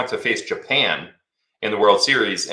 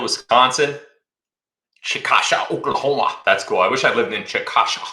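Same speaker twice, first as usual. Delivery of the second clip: ordinary talk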